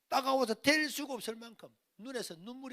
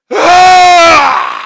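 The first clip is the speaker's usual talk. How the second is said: sudden loud wailing cry; 10 semitones higher, 33 dB louder, much slower than usual. Retold or imitated. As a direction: let a big sob-wail burst out. Bursting into tears, retold.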